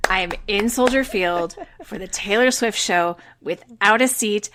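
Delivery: ordinary talk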